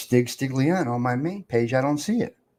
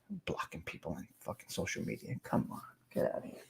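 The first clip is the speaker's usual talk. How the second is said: sarcastic whisper; 11 semitones lower, 17 dB quieter than usual. Acted. Mocking the idea of blocking.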